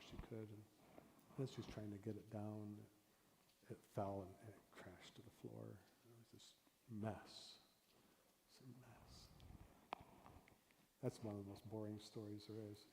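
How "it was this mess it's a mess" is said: slow, soft, dismayed murmur; dismayed hush. Acted. Teacher tells the broken peach jar softly. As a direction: let the mess sink in quietly. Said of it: "demo story crash".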